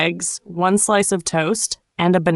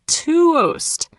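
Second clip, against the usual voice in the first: "toast" stretched long, higher pitched; slow demo; 8 semitones higher than usual. Shown as a demonstration. Teacher repeats it for learners, slowly. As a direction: draw out "toast" as "tuh-oast". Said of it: "Let's say it slowly".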